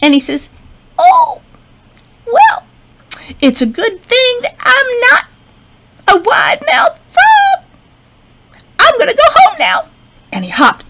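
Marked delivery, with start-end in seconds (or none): 1.0-9.9 very high squeaky frightened frog; scared tiny voice. Acted. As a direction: squeak it out in a panic.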